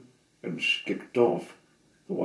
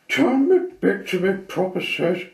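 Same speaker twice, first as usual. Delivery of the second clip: loud, higher furious order; tiny man's furious demand; indignant demand; 11 semitones higher, 10 dB louder than usual. Acted. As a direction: shout the command in outrage. Shrunk man demands angrily.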